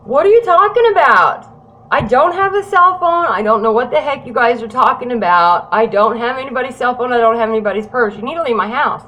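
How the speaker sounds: loud, high-pitched indignant outburst; angry denial. Retold or imitated. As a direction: re-enact yelling defiantly at the police. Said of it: "I'm getting really mad".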